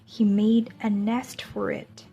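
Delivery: put-on gentle picture-book voice for young listeners; storybook reading. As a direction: read it like story time in class. Reads a picture book to children.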